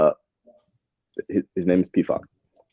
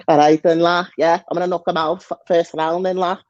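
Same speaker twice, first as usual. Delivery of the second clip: mock Scouse accent, pitched up; caricatured Liverpool accent; Paddy the Baddy; 6 semitones higher than usual. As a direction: put on a cartoonish Scouse accent, higher than usual. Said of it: "do an accent like Paddy the Baddy".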